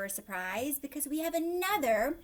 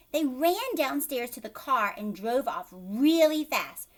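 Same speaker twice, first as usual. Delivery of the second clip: ordinary delivery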